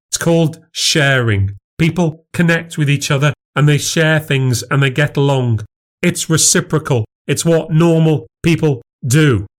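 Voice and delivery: pointed explaining. Acted. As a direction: spell out what normal people do. Wife explains in quarrel.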